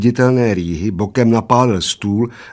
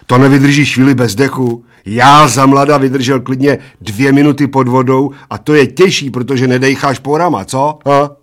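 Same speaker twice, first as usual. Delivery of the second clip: loud, raised bragging; boastful bald giant; 4 semitones higher, 7 dB louder than usual. A narrator's reading. Bald giant brags of holding breath, boastful. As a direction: brag loudly about your youth.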